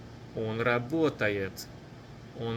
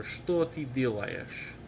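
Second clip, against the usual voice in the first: more connected, still careful teaching pace; somewhat faster; slower than usual. Shown as a demonstration. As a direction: say the question in one smoother, quicker run. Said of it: "Or faster".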